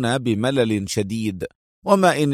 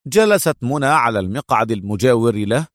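ordinary delivery

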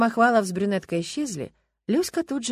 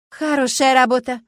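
louder, sarcastic jab; loud sarcasm; 5 semitones higher, 10 dB louder than usual. A narrator's reading. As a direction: say it loud for all to hear. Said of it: "громко сказала Люська".

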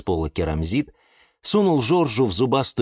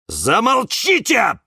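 high, drawn-out angry cry; high-pitched slow yell; tantrum; 14 semitones higher, 4 dB louder, slower than usual. A narrator's reading. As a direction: demand silence like a stamping child. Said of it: "топал ногами кактус Кирилл".

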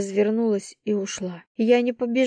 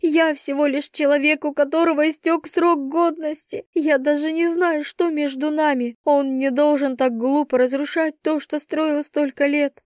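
high, slowed, anguished delivery; hysterical despair; 6 semitones higher than usual. A narrator's reading. Girl pleading to her friend about him, hysterical. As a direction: pour out despair in a raised pitch. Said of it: "И я билась в истерике".

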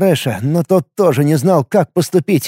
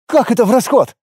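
hysterical outburst; 7 semitones higher than usual. A narrator's reading. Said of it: "взбеленился истеричный Таталем Со".

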